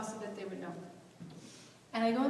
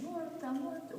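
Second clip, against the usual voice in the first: retold Italian scream; 8 semitones higher, 5 dB quieter than usual. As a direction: imitate grandmother screaming in Italian, voice held back. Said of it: "she's screaming in Italian".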